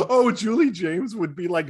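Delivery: ordinary talk, nothing marked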